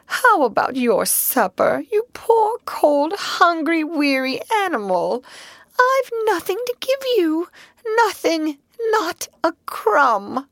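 high, tearful, slowing lament; despairing. Acted. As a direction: wail it in despair. Mole in dark despair.

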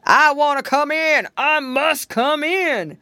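loud, high-pitched demanding call; crying out. A narrator's reading. Bear calling from the porch to be let in, crying out. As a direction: cry it out, big and insistent. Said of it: "a voice cried".

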